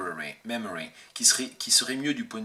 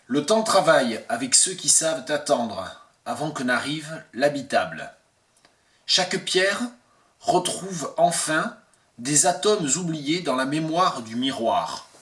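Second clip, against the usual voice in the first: higher, louder poetry reading; poem recitation; 4 semitones higher, 5 dB louder than usual. Acted. Translator reads the French poem to viewers, projected recitation. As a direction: recite the verse out, projected above your usual talk.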